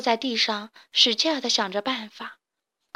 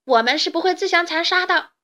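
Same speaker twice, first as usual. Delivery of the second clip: higher, louder furious defiance; angry shout; 6 semitones higher, 6 dB louder than usual. A narrator's reading. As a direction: yell it at the fox in the arena. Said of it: "卡梅利多愤怒的大喊". (愤怒地大喊)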